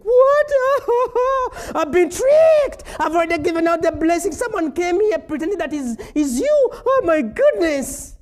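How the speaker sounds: hammed-up shock of being tricked; shocked father. Old father finding he was tricked, hammed-up shrieking panic.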